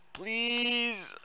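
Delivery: high, drawn-out plea; stretched-out, high-pitched begging; pleading. Real, not acted. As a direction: beg in a long high wail. Begging the game.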